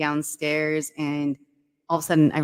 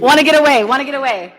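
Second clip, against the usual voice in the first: high, loud tagline chant; mocking slogan call; 8 semitones higher, 12 dB louder than usual. Calling out mocking airline tagline.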